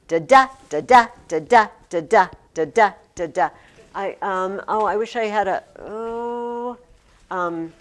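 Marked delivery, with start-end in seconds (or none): 0.1-3.5 steady weak-STRONG beat on every "da, da"; iambic rhythm. Shown as a demonstration. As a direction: chant "da, da" in pairs, each second "da" punched. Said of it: "Here's iambic".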